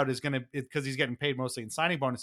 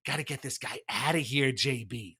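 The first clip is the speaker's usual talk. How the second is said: mock disgusted hiss; 4 dB quieter than usual. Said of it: "hissing, kinda, like, almost disgusted way".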